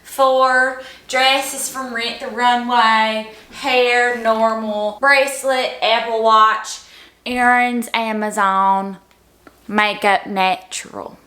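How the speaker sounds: slow, drawn-out OOTD announcer voice; rush TikTok voice. Pretend. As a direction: drag each item out like a sorority girl. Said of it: "Let's try this again".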